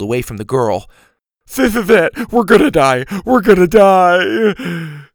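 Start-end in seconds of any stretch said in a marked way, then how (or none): 1.5-5.1 high, loud panicked cry; shaky stammering panic. Acted. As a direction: panic like you're about to fall. Mac panicking at the edge.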